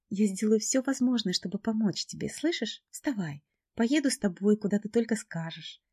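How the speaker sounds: high, earnest reassurance; reassuring friend. A narrator's reading. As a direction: promise help warmly. Friend promises help.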